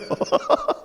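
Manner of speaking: short, high-pitched real laugh. Real, not acted. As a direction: let out a brief high laugh. Preacher laughs after his remark. (짧고 높은 웃음)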